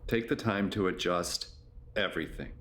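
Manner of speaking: low, measured yoga nidra voice with long pauses; guided meditation. Acted. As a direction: guide them gently into rest, low-pitched and unhurried.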